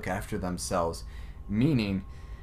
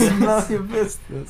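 laughing; 9 semitones higher, 4 dB louder than usual. Pretend. Echoing joke, laughing.

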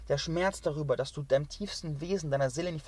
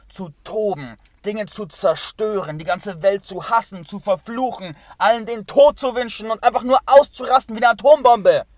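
starts loud and swells into a full, higher roar; building to a shout; 5 semitones higher, 13 dB louder than usual. Real, not acted. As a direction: let it erupt like the bomb he names. Lists raging, builds to explosion.

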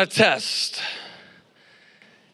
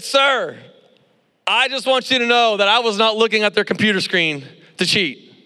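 raised, insistent retold protest; emphatic denial; 4 semitones higher, 4 dB louder than usual. Accused student to his professor, emphatic high denial. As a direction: protest firmly in higher pitch.

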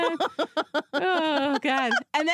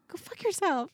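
higher, softer sarcastic snipe; mom's mock retort; mock deadpan fury; 10 semitones higher, 4 dB quieter than usual. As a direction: spit it under your breath. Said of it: "I'm like".

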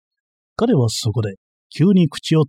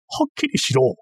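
sharp, much higher demand; a sudden raised cry for clarity; 13 semitones higher than usual. A narrator's reading. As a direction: snap it out, pitch jumping up. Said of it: "彼は声を高めた".

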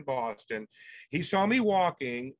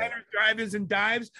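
a hint of a TV food host, a bit higher; Guy Fieri; 5 semitones higher, 3 dB louder than usual. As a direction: lightly hype a food show title. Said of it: "I do, like, a Guy Fieri kind of a thing".

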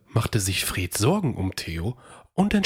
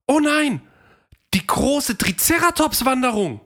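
high and louder, hammed-up fright; mock alarm; 4 dB louder than usual. A narrator's reading. Theo fears the triceratops migration, mock alarm. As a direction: gasp at the idea of stampeding triceratops.

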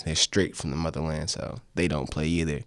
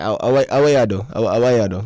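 put-on loud, higher Jamaican-accented scolding; Jamaican parent yelling; 4 semitones higher, 9 dB louder than usual. Guest imitates parents telling him off, loud Jamaican accent. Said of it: "my parents yelled at me in Jamaican".

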